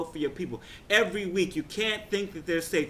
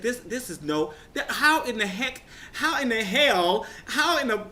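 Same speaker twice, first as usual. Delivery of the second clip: high, giggling disbelief; reliving a giddy gasp; giddy laughing; 8 semitones higher than usual. Retold or imitated. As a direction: laugh through the stammered shock. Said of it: "I got giddy".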